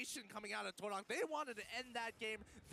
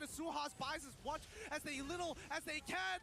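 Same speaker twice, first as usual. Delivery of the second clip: esports caster; 10 semitones higher, 5 dB louder than usual. Real, not acted. Hyped play-by-play of a fight.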